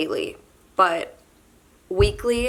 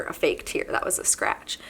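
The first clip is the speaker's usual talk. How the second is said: whispered, much lower aside; embarrassed aside; 9 semitones lower, 6 dB quieter than usual. Real, not acted. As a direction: brush off the tear under her breath. Embarrassed aside hiding a tear.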